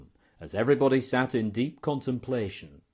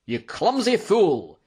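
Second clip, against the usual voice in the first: slow, loud, high furious outburst; angry shout; 8 semitones higher, 6 dB louder, slower than usual. A narrator's reading. Innkeeper to the waiter who broke crockery, shouting. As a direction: shout it, drawing out each word. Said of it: "shouted MacFiber".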